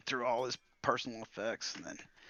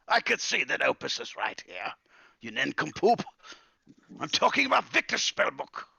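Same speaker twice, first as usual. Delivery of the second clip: irate wizard; 5 semitones higher, 3 dB louder than usual. Acted. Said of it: "He goes".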